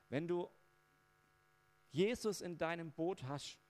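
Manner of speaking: ordinary talk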